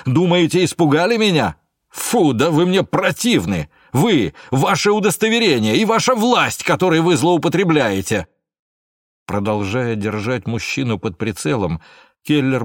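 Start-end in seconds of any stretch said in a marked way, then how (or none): 0.0-8.3 loud, high-pitched, contemptuous rage; furious tirade. A narrator's reading. Suspect defies armed gendarme and his badge, furious tirade. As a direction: spit out disgust, voice soaring in anger. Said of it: "с яростью спросил мужчина".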